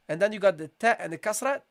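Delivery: ordinary talk, nothing marked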